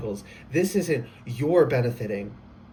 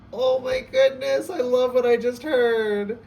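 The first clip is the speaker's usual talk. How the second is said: high, slow, gushing delight; real excitement; thrilled; 9 semitones higher, 4 dB louder, slower than usual. Real, not acted. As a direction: let the joy burst out, stretching goodness. Reader thrilled by the tarot cards.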